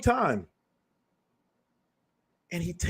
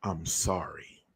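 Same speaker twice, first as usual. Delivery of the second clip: sincere apology; 10 semitones lower, 9 dB quieter, slower than usual. Retold or imitated. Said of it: "real people say".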